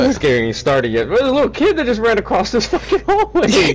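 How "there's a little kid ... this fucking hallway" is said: high-pitched, laughing fright; jittery scared yelp with nervous laughs; startled panic. Real, not acted. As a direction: squeal it, spooked and half laughing.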